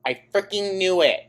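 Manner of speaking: co-host crowing that she called it; triumphant. Real, not acted. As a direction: exult at being proven right. Vindicated outburst.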